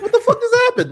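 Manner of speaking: high, loud, laughing disbelief; baffled outrage. Retold or imitated. Confused viewer when the film cuts off, high and loud. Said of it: "I was like".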